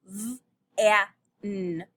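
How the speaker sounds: "V-a-n" broken into separate sounds; sounding out. Shown as a demonstration. Teacher segmenting van for children, slowly. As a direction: sound out "V-a-n" piece by piece. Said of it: "Do you hear a?".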